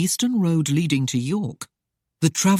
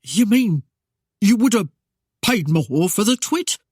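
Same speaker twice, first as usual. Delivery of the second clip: higher, louder rustic mayor, gaping; astonished; 5 semitones higher, 4 dB louder than usual. A narrator's reading. Mayor learning he could have got more, astonished. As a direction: blurt it out in disbelief. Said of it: "closed his gaping mouth".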